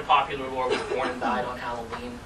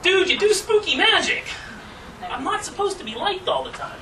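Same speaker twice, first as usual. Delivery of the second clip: snippy retort; 4 dB louder than usual. Retold or imitated. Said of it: "It's like".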